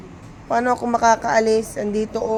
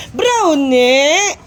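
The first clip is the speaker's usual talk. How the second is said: calling the dog; 5 semitones higher, 4 dB louder, much slower than usual. Real, not acted.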